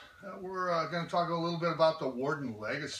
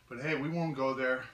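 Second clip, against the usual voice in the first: ordinary talk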